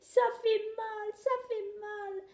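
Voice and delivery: voix très aiguë et lente, plaintive; gémis de peur comme une petite tortue; petite tortue apeurée. A narrator's reading. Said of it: "elle a peur". (tortue apeurée qui gémit, voix aiguë et lente)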